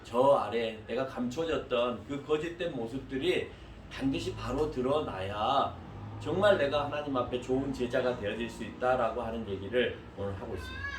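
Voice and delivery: normal talking voice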